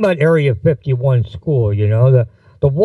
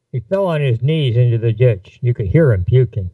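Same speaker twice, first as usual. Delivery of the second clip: plain reading voice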